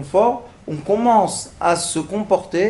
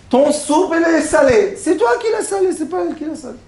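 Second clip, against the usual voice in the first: mock scolding; 8 semitones higher, faster than usual. Scolding husband over salty soup.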